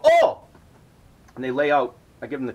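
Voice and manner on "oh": very high, loud "Oh!"; a sudden got-it exclamation; mock realization. Hosts understanding he wants to sniff, high loud mock "Oh!". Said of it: "So they go".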